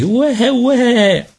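put-on loud, very high baby cry; gridato. A narrator's reading. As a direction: wail like an infant. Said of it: "le gridò lui". (strillo acuto da neonato)

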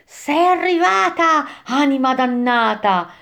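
high, drawn-out demon cry; shouting demon. A narrator's reading. Demon boatman crying out to a damned soul, shouting. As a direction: call it out slowly in a put-on demon's voice. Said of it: "un demonio che gridava".

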